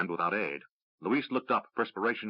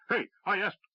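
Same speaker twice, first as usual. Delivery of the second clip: calling out; 11 semitones higher than usual. A narrator's reading. Rider calling out.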